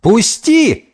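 high, sharp cry; shouted demand. A narrator's reading. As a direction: cry out an order to let go. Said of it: "закричал Гомес". (высокий требовательный крик)

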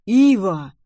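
loud, admonishing call of a name; stern exclaimed reproach; reproachful. A narrator's reading. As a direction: call the child to order. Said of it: "с упрёком в голосе воскликнул папа".

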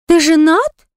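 high, loud, shocked gasp. Acted. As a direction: gasp it out in disbelief. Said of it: "охнула Лола".

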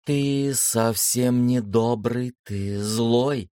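lightly voiced sister, firmly convinced; convinced girl. A narrator's reading. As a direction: state it as settled fact, like a child. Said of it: "убеждённо заявила Света".

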